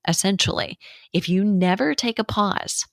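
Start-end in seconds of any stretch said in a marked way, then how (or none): none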